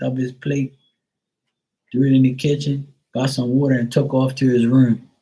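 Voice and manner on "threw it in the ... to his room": usual speaking voice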